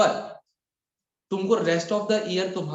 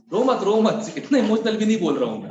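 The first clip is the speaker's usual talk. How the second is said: higher, louder, hurried comforting; calm her down, raising the voice; loud reassurance; 6 semitones higher, 5 dB louder than usual. Real, not acted. Teacher to a crying student, loud hurried comfort.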